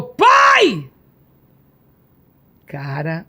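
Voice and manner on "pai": high, loud cry of Pai; retold shout. Crying out to the Father in her vision, high and loud. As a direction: call out to the father with everything. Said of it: "a minha boca gritou".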